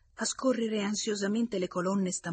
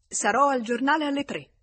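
raised, high parting announcement; calling out; 8 semitones higher, 8 dB louder than usual. A narrator's reading. Visitor telling his friend as he leaves, calling out. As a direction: throw it out loudly on the way out.

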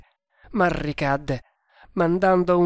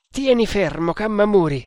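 higher, louder order; urgent command; 6 semitones higher, 4 dB louder than usual. Acted. Tremal Naik tells his helper to hold the prisoner, commanding. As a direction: order your helper firmly, raised voice.

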